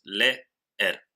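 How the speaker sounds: leer split as le... er, with a clear pause; syllable by syllable. Shown as a demonstration. Teacher separates two strong vowels in leer, syllable by syllable. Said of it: "las separamos en dos sílabas".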